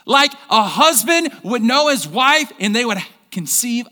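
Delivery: loud, high preaching at full force; fired-up preaching. Acted. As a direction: preach it out to the whole church. Pastor preaching to congregation.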